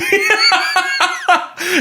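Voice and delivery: squealing laughter; high laugh. Real, not acted. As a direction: crack up laughing. Laughing at the free jersey joke.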